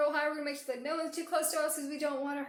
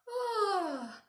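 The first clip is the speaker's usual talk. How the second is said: high falling "ah" of waking; loud stretch-and-yawn "ah"; waking-up sigh; 10 semitones higher, 5 dB louder than usual. A narrator's reading. Chameleon waking up in story.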